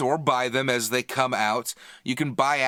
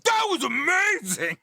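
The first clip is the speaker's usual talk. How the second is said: high, drawn-out gushing exclaim; imitating a thrilled moviegoer, high and gushing; mock amazed shout. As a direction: gush like a thrilled fan leaving the cinema. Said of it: "you've come out of it going".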